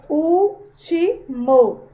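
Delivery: "Último" very slowly split as úl-ti-mo; syllable by syllable. Shown as a demonstration. Teacher separates the word for pupils, "Último" syllable by syllable. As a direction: break "Último" into three loud, drawn-out syllables. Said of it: "Se a gente for separar".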